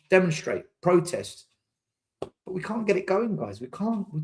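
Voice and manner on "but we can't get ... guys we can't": ordinary delivery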